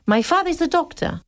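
"My father is a doctor" with a wide, melodic tune; wide English pitch range. Shown as a demonstration. Teacher shows the wide English pitch range, sing-song. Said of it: "ellos dirían".

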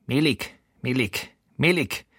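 mild disgusted scolding; understated mealy-apple grumble; grumbling. A narrator's reading. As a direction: spit out the word in disgust. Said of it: "schimpft er".

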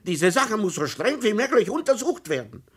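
an offended citizen scolding the police; indignant tirade. A narrator's reading. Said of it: "ereiferte sich Herr Tomsa". (empörte, laute Tirade)

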